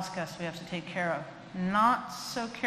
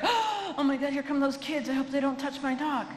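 high, fretful owner's worry; hammed-up fearful owner; mock alarm; 7 semitones higher than usual. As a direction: imitate a nervous dog owner.

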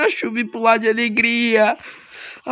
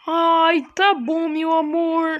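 high, sweet wife voice; lightly sung-out high wife voice; 6 semitones higher, slower than usual. Acted. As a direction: greet him as the doting wife. Plays wife sending him off.

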